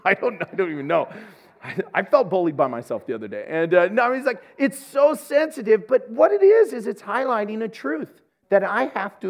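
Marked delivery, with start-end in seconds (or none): none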